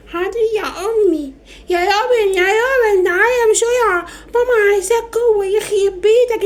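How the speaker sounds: put-on high-pitched little girl calling her brother; child Maria running an errand. (صوت بنت صغيرة رفيع بتنادي)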